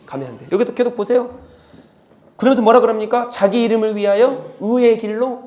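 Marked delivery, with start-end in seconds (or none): 0.0-0.5 much softer, low pleading warning; Lord's soft warning. Acted. The Lord warning a straying sheep, soft and low. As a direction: hush it like a gentle plea. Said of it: "그럴 때 주님 뭐 하십니까?".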